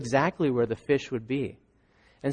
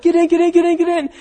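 very high, loud hurrying cries; urgent shout; 13 semitones higher, 6 dB louder, much faster than usual. Acted. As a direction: hurry everyone to shore. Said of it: "they're like".

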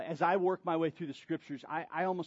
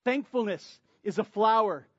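preaching at full force; 4 semitones higher, 7 dB louder than usual. Acted. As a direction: build it like a pulpit crescendo. Preaching builds to climax.

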